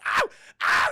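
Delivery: loud acted "ow"; hammed-up cries of pain; pained yelps. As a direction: yelp under punches. Bully being beaten.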